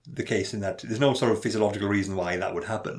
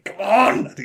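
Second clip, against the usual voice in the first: high, loud strained yell; imitating an exasperated man; angry at himself; 10 semitones higher, 7 dB louder than usual. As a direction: burst out in frustration. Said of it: "getting angry at the fact that he's crying".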